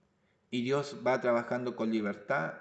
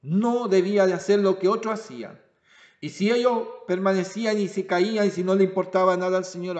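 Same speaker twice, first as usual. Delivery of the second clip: preaching; 5 semitones higher, 7 dB louder than usual. Acted. Preaching at full force.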